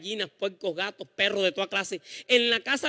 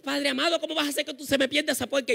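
higher, fast desperate mother's plea; mother pleading; 4 semitones higher than usual. Retold or imitated. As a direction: beg God for the pig. Y, y mami. (mami suplica desesperada, más aguda)

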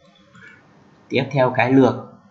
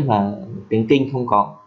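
usual speaking voice

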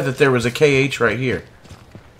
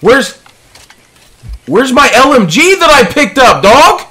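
screaming, much higher and louder; berserk rage; 12 semitones higher, 9 dB louder, slower than usual. Real, not acted. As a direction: lose it completely, slow at end. Lost weapon, gamer rage.

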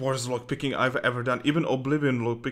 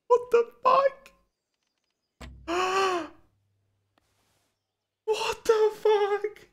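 high disbelief; slower than usual. Real, not acted. Game keeps failing.

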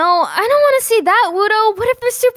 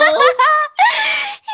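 high-pitched cackling laugh; 9 semitones higher than usual. Acted. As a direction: burst of high, helpless laughter. Bursts out laughing at the turtle.

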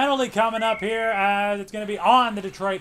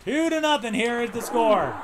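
higher broadcaster score call; announcer call; 4 semitones higher than usual. Acted. Gamer calling the score like a broadcaster. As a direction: read the score like a TV caster.

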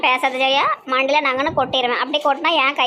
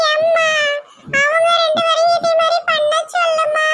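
put-on very high-pitched kid voice; demanding child; 3 dB louder than usual. Child wants the other two to copy, nagging. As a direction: nag in a piping child's voice.

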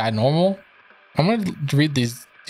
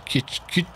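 soft creature clicks; 4 semitones higher, 6 dB quieter than usual. Acted. Creature noise again.

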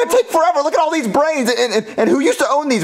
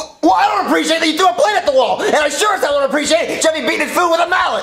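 high, raised-voice complaint; yelling; 6 semitones higher than usual. Acted. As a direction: raise your voice in protest. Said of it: "There you go, yelling again".

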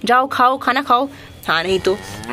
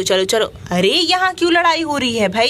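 normal talking voice

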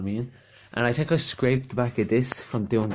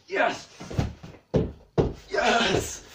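excited cheer; 12 semitones higher than usual. Real, not acted. Cheering at seeing the chair.

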